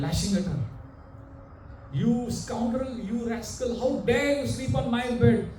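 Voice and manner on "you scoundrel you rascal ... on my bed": high-pitched mock scolding; acted fury. Master lashing the maid, acted.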